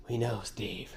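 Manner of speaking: soft ominous whisper. Pretend. Host creepily whispering to Steve. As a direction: breathe it like a threat.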